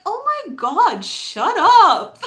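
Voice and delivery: high, swooping mock exasperation, loud finish; exasperated dismissal. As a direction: brush off the doubter, punching the end. Acted dismissal of doubters.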